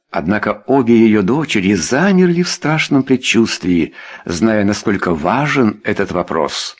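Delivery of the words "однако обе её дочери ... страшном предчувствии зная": ordinary delivery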